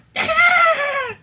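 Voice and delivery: high, shrieking hyena cackle; hyena laugh. Pretend. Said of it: "Insert hyena laugh".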